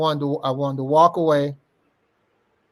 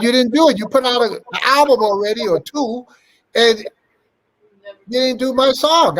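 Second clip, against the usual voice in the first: heated complaint; 6 semitones higher than usual. Retold or imitated. Retold argument with [name].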